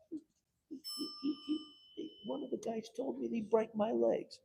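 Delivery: high, stammering frightened resident; scared resident. Retold or imitated. Frightened resident tells the councilman about threats, high and stammering.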